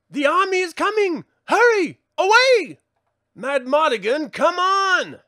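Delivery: high, loud warning cries; alarmed shouting. Acted. To the fleeing group as the army comes, high loud alarmed calls.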